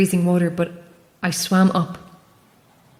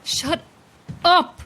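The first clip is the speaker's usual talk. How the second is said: furious outburst at the voice; angry shout; 9 semitones higher than usual. Acted. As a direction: cut him off hard. Refuses to hear the truth.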